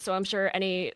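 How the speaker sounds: normal talking voice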